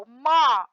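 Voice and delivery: "mó" said apart, loud and punched; tonic syllable shown. Shown as a demonstration. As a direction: stress the syllable "mó" on its own. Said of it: "olha aqui, ó". (sílaba "mó" destacada com força)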